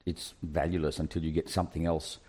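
ordinary talk

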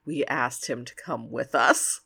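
low, hushed aside; confiding a secret. Retold or imitated. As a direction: lean in and share it like a secret, voice dropped. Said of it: "like he's telling him a secret".

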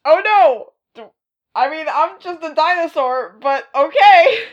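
high, loud mock whine; squeaky put-upon dinosaur. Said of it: "Devil Dinosaur's like".

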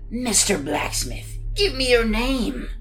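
lightly voiced looming monster; a hint of a creeping monster. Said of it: "said the monster".

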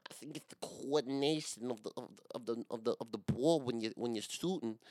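high lispy Mike Tyson voice, stumbling over the words; Mike Tyson impression. Mocking his own stumble to listeners as Mike Tyson, high lisping voice. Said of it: "Mike Tyson".

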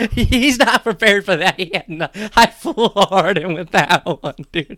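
talking through laughter; laughing. Real, not acted. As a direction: laugh while teasing. Laughing taunt.

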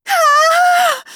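high, loud scream; piercing scream of alarm. A narrator's reading. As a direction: let out a full, high-pitched shriek. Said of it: "Cassie screamed".

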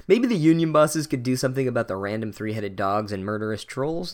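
ordinary talk, nothing marked

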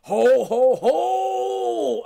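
high, loud Santa ho ho ho; roaring Santa laugh. A narrator's reading. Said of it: "I roared".

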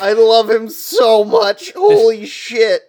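high, loud, drawn-out adoration; real excited fan outburst; gushing. Real, not acted. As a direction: gush with real delight, stretching every word. Reader gushes over a favourite character.

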